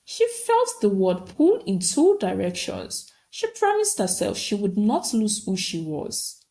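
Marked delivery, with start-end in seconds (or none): none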